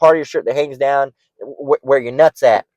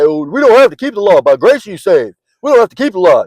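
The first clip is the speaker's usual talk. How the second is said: higher, louder, sneering mock voice; mocking the bug outs; 6 semitones higher, 6 dB louder than usual. Preacher mocking bug outs who reject the law, higher louder mock voice. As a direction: parody a smug believer brushing off the law. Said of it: "I'm mocking the bug outs that say".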